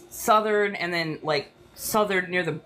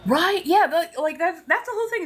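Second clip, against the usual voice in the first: worked-up rant; 10 semitones higher than usual. Real, not acted. Resuming rant excitedly.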